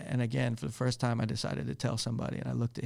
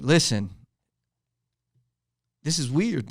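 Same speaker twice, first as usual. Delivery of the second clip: retold yelling; 3 semitones higher, 8 dB louder than usual. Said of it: "after I yelled at him".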